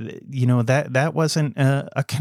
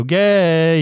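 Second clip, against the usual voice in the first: loud, higher jeering blurt; a boy breaking an emotional moment with a loud taunt; mock blurt; 6 semitones higher, 6 dB louder than usual. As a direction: hit the ejector switch. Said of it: "the ejector switch is to just go".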